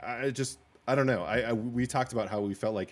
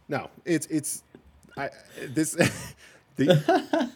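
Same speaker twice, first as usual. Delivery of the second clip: breaking up laughing; 7 semitones higher, 4 dB louder, much slower than usual. Real, not acted. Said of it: "That was terrible at the beginning".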